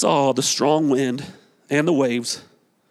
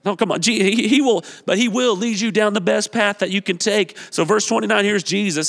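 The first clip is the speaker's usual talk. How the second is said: usual speaking voice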